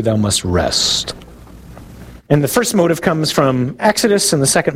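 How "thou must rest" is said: slow, lower, weighted commandment; solemn commandment. Shown as a demonstration. Preacher summing up the commandments, slow and solemn. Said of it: "they kind of boil down to".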